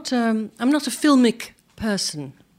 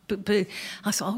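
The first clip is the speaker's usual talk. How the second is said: ordinary delivery, nothing marked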